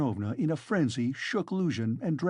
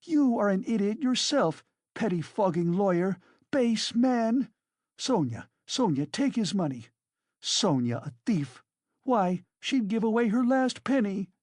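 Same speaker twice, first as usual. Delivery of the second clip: hysterical tirade; 8 semitones higher, 3 dB louder than usual. A narrator's reading.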